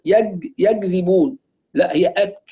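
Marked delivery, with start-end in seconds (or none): 0.1-1.4 يكذبون said slowly as yag-dhibun; kaf as hard g. Retold or imitated. Teacher repeats what is sometimes heard, kaf as g. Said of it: "نسمع كده الكاف أحيانًا".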